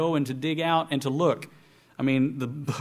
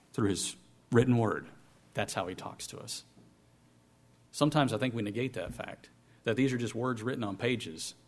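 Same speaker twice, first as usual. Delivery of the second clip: sincere; 4 semitones lower, 6 dB quieter than usual. Real, not acted. Soft earnest aside.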